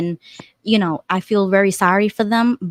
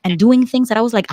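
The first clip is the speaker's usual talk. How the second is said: ordinary talk, nothing marked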